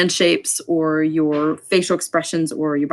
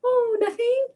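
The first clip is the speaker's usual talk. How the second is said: put-on much higher, softer kid answering innocently; shy child brushing off the question; 4 dB quieter than usual. Shown as a demonstration. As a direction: pitch way up and small like a little boy. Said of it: "whenever you change, you know, the character".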